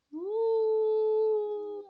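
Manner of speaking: high, loud hooting owl. Acted. Owl hooting in the night near Henry, high and long.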